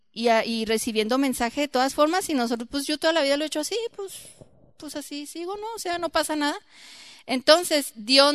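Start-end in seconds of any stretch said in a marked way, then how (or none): 2.7-5.7 fast, higher stubborn excuse; quick mock of a stubborn believer. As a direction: rattle off a careless excuse.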